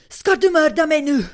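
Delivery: high, loud, drawn-out outburst; agitated neighbour. Retold or imitated. Neighbour to tenant at her door, agitated shouting. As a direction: wail it out loudly, imitating the neighbour.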